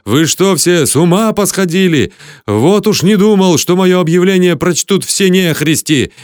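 loud, high exasperated outburst; howling. A narrator's reading. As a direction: wail in disbelief at the crowd, loud and high. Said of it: "взвыл я". (возмущённый вопль, громко и высоко)

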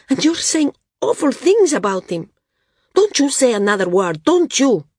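high, loud, hurt accusation; angry outburst. Acted. Srella's angry outburst at Val.

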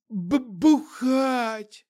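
very slow, high stammering zombie. A narrator's reading. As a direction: drag it out like a drunk zombie. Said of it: "ответил Петрович".

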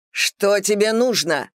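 a touch of a desperate shout. Acted. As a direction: call it out, strained. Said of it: "закричал Гаррет".

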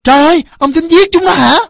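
high, loud horrified protest; distraught mother's loud cry. A narrator's reading. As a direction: scream at him to stop. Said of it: "kêu lên".

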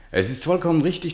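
high, lively statesman's voice; Malraux voice. Acted. Malraux speaks to de Gaulle by the fire, voice pitched high.

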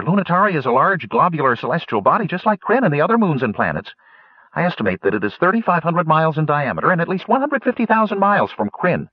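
very high, quick, eager gnome voice; excited gnome. Acted. Citer announces where the ship is heading, very high and eager. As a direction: blurt out the discovery like a thrilled little inventor.

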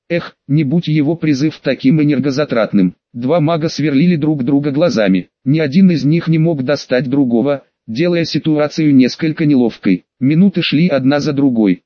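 plain reading voice

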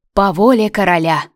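громкий выкрик глашатая; объяви звонко на всю площадь; глашатай. A narrator's reading. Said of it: "голос молодого глашатая, как из бочки". (голос глашатая, как из бочки)

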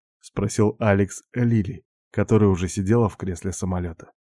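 usual speaking voice

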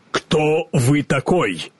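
slow, stern challenge. A narrator's reading. The Woodman sternly questions the stranger. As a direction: demand it sternly. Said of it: "сурово спросил он".

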